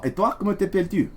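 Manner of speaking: normal talking voice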